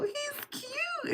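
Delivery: very high, soft imitated squeal; Rufus laughing. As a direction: mimic a tickled friend way up high. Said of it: "he starts crying, laughing".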